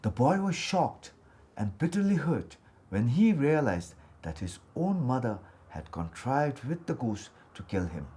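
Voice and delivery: plain reading voice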